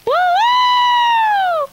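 very high loud whooping call to the house; ringing high falsetto woo calls; water call holler. Shown as a demonstration. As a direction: holler home for water from the field. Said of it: "a water call ... it goes like this".

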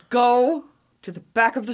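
very serious command. Retold or imitated. Spooked employee orders laughing coworker to the back, very serious. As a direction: imitate a spooked coworker insisting, each word stretched. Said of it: "she is very serious".

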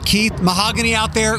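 normal talking voice

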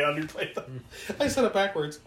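ordinary delivery

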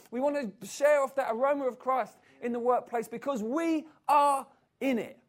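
rally the church with rising passion; full-force preaching. Acted. Preacher rallying his church to engage the world, building to shouts.

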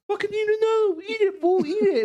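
high-pitched imitation of a pushy friend; mock goading. Imitating a friend daring him to eat spicy chips, high mock goading. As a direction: egg him on in a squealed mock voice.

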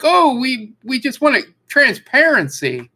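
high mock-innocent start, dropping on the last word; mock innocence. Streamer mocking Twitch's official statement, mock innocence. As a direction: imitate a company feigning good intentions.

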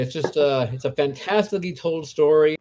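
ordinary talk, nothing marked

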